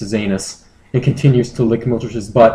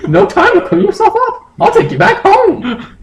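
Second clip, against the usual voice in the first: very high falsetto, breaking into a laugh; squeaky creature voice, giggling at the end; high Moltres voice; 5 dB louder than usual. Acted. Moltres instructing Justin, high and giggly.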